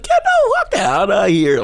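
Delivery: high, exaggerated Northern Canadian surfer voice; Northern Canadian surfer accent. Shown as a demonstration. Comedian mimicking Northern Canadians, high surfer-like Canadian accent. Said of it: "they sound like surfers with no beach".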